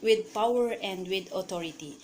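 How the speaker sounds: ordinary delivery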